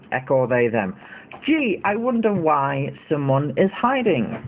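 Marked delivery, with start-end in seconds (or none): 1.4-4.4 high, drawn-out mock puzzlement; sarcastic wondering. Streamer mocks accusers over someone hiding, sarcastic wondering. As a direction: wonder aloud with heavy sarcasm.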